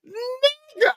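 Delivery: shrieking in disbelief; high shriek. Real, not acted. Hysterical reaction.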